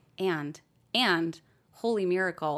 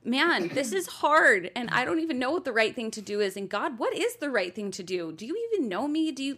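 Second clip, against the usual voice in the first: higher, louder cry of struggle; exasperated plea; 6 semitones higher, 5 dB louder than usual. Acted. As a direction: plead with God in mock exasperation. Acting out a struggling plea to God.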